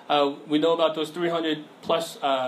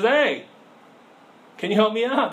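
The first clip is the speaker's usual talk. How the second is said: higher, louder call for help upward; calling out from a pit; 6 semitones higher, 4 dB louder than usual. Acted. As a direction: shout up to the passerby. Man in hole calls out.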